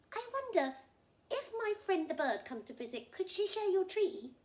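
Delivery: high, loud crocodile asking a favour; hopeful crocodile. Acted. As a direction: ask eagerly in character. Crocodile asks monkeys a favour.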